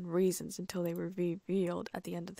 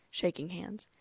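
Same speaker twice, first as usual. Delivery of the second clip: plain reading voice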